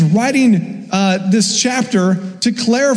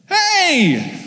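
cheery greeting; 8 semitones higher than usual. Retold or imitated. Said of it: "and he's like".

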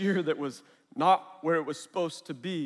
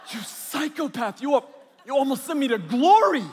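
exasperated scolding; 9 semitones higher, 8 dB louder than usual. Retold or imitated. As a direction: scold like a badly startled parent. Startled parent scolds child.

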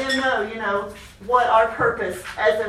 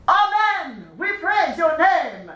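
higher, proud declaration, slow; 7 semitones higher, slower than usual. Shown as a demonstration. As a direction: declare it proudly like a warrior king.

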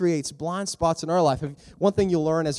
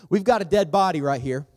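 ordinary talk

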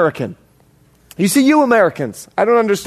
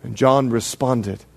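ordinary talk

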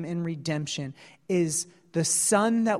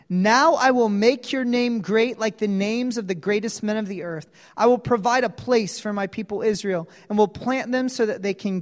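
loud, emphatic Bible reading; fast, loud proclamation of the verses; scripture reading; 3 semitones higher, 5 dB louder, faster than usual. Real, not acted. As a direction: proclaim the text to the congregation.

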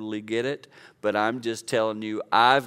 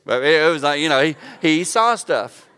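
rising louder and higher with delight; excited; 5 semitones higher, 9 dB louder than usual. Real, not acted. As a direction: burst out with joy at the memory. Excited about son's feedback.

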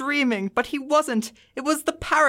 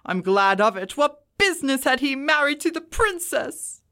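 between laughing and crying; 2 semitones higher than usual. A narrator's reading. Scrooge reliving his boyhood storybook heroes, excited and earnest. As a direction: exclaim in earnest delight, close to laughter and tears. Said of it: "extraordinary voice between laughing and crying".

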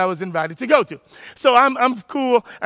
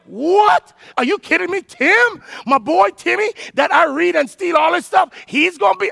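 excited disbelief; 7 semitones higher than usual. Retold or imitated. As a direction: squeal with shocked excitement at the name. Star speaker on the list.

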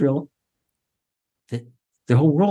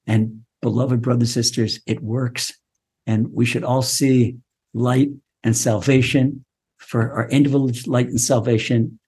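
low, steady prayerful benediction; closing blessing; 4 semitones lower than usual. Acted. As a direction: bless the listeners earnestly.

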